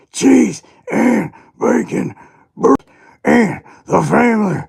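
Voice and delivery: a hammed-up wrestler voice; put-on character voice. Streamer voicing Waddy's line for chat, high and loud. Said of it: "I need a voice for him".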